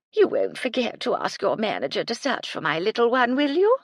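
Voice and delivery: put-on high, pleading old lady; begging with a rising whine; plaintive. A narrator's reading. Lady Belville pleading with Cecily to find her bird. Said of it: "in a plaintive voice".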